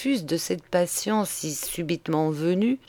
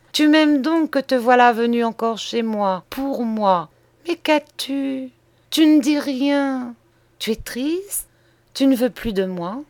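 higher-pitched, tender questioning of a lover; anxious woman in love; 5 semitones higher than usual. Acted. Voices the woman to her lover. (amoureuse inquiète, voix plus aiguë)

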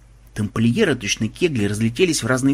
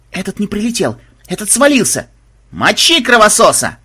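high, excited yelling building louder; raised voice mounting to a battle cry; agitated shouting; 8 semitones higher, 6 dB louder than usual. A narrator's reading. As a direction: holler to rally an attack. Templars shouting before attacking.

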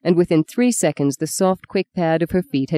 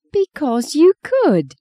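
high, drawn-out indignation; indignant; 9 semitones higher, slower than usual. A narrator's reading. Woman insisting her friend could charm anyone, indignant. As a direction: protest slowly, pitched well up. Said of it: "she said indignantly".

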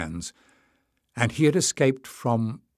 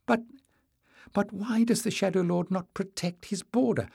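put-on higher, stammering nervous boy; high trembling voice; 9 semitones higher than usual. A narrator's reading. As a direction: quaver it out, scared. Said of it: "in a high, trembling voice".

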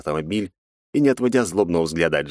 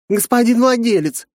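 put-on excited girl voice; 6 dB louder than usual. Жанна радостно бросается на Масаши.

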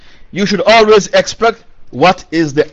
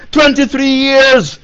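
"Twenty-three years" stretched slowly, pitched higher; emphatic repetition; 5 semitones higher, slower than usual. Real, not acted. Contrasts it with Noah's centuries.

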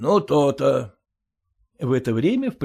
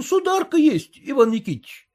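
higher, eyes-down confession; abashed clerk; 13 semitones higher, 4 dB louder than usual. A narrator's reading. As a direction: admit it sheepishly to the master. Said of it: "потупясь дал ответ приказчик".

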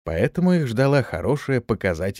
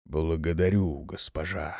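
deep rumble, much lower and softer; rumbling low voice; 6 semitones lower, 6 dB quieter than usual. Acted. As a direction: rumble it like a big demon. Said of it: "пророкотал он".

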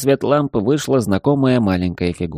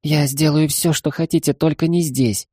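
put-on begging young maid; 7 semitones higher than usual. Maid begs, high voice.

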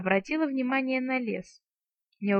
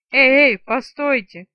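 very loud, slow desperate scream; frantic yell; 3 semitones higher, 11 dB louder than usual. A narrator's reading. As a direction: yell to stop them fleeing. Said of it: "истошно завопил он". (истошный вопль)

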